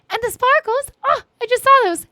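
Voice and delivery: a burst of happy surprise; excited. Real, not acted. Noticing the sparkles in chat.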